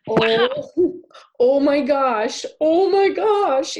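startled by the horse, cry out high and slow; shocked gasp. Retold or imitated. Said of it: "And I went".

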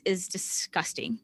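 slow, breathy hushed "disgusting"; disgusted whisper. Real, not acted. Host condemning the media's words: slow disgusted whisper. Outraged about media wording.

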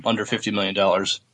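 normal talking voice

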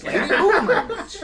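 high-pitched laughing mockery of moon language; mocking high squeal. Player mocks the NPC's speech, laughing high and squeaky.

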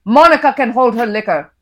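put-on loud, cutting husband with an Indian accent; Indian father's voice. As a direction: fire the jibe loudly at your wife. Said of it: "I read in my characters' voices".

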